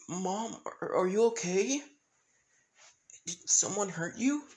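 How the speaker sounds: high-pitched hesitant worry; acted worried child; scared, worried. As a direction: stammer a little, asking anxiously. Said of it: "Eri pretty much scared".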